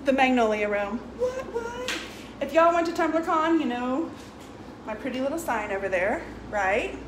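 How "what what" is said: squeaky high surprise; high squeak. Real, not acted. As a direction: squeak it playfully. Squeaky exclamation.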